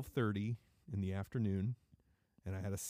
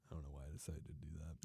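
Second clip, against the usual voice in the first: embarrassed whisper; 7 semitones lower, 15 dB quieter, faster than usual. Real, not acted. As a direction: breathe it out, embarrassed. Embarrassed after the bit.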